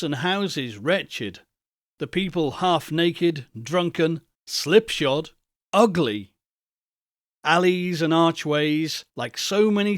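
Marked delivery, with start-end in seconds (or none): none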